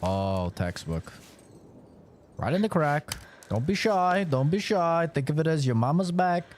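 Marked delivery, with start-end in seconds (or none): none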